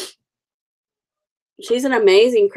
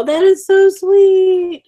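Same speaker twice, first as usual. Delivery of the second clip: gushing; 10 semitones higher, 5 dB louder, slower than usual. Real, not acted. Touched by a viewer's kind comment.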